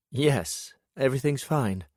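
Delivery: a hint of a cheerful woman; lightly voiced woman. Lillian on the phone.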